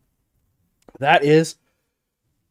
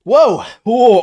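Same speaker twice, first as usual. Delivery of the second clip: loud gasp; 8 semitones higher, 8 dB louder than usual. Real, not acted. Reacting to burning hot sauce.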